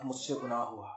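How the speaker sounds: a broken king owning his sin; hushed confession. Acted. David confessing to the prophet, soft and low.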